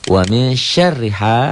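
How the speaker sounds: "ha" held long at the end of the chant; mad tabi'i. Shown as a demonstration. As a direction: recite high and slow, lengthening "ha". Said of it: "ha mad tabi'i". ("ha" dibaca panjang satu alif)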